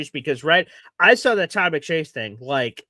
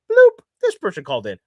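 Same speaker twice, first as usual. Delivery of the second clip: a high, louder bloop sound, then the words; call-line bloop; 14 semitones higher, 6 dB louder than usual. Retold or imitated. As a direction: pop it out like an incoming call alert. Imitating the call line's sound.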